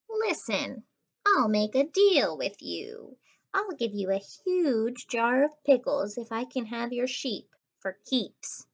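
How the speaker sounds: put-on a sly, scheming girl's voice, slowing at the end; scheming girl bargaining for the sheep; sly deal-maker girl. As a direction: tempt them with a shady deal.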